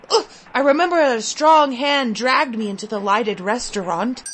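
usual speaking voice

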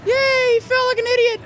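high, loud mocking cheer; gloating cheer. Real, not acted. Cheering as the monster trips, loud gloat. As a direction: crow over the clumsy monster.

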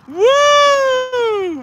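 long, high "woo"; drawn-out falsetto holler; high whoop. Real, not acted. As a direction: whoop it out and hold it. Whoops after laughing at the name.

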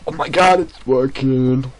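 high, loud delighted exclaim; excited. Real, not acted. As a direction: burst out, thrilled it works. Excited at mining success.

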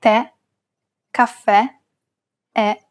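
stressed open E, as in "bet", in "Tè, caffè, è". Shown as a demonstration. Teacher showing grave accent on E, open stressed E in "Tè, caffè, è". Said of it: "pronounce it as an open E".

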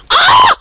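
high, loud "ah!"; short high battle yell; loud scream. Real, not acted. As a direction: scream out mid-fight. Screaming during game fight.

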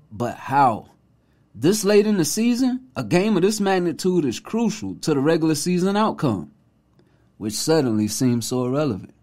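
measured reading with dramatic pauses; thoughtful journalist read. Shown as a demonstration. Reading the tweet as a real journalist would, measured and thoughtful. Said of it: "let me read it to you how I read it".

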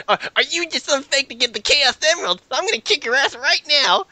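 mock the hotheaded hero; hammed-up threat in a high voice; mocking Silver. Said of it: "Silver's all like".

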